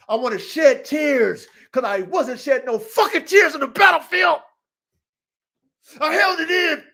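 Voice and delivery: high, loud, impassioned; passionate shouting. Real, not acted. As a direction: preach it with fire. Passionate vow about tears.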